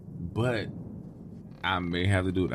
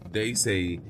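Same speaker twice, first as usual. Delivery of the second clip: plain reading voice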